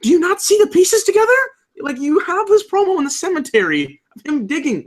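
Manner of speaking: incredulous, almost squeaking with frustration; exasperated disbelief. Retold or imitated. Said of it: "I'm like".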